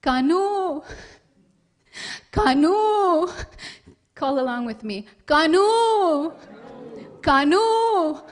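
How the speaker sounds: high, loud loving calls; long falling calls of the name; mother calling out. Acted. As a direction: call the child home across the fields. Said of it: "calls out".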